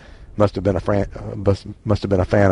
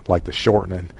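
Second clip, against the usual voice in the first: normal talking voice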